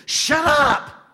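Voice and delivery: loud, high "Shut up!" blasted out; angry shout. Retold or imitated. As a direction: yell over the kids to silence them. Parent yelling at noisy kids.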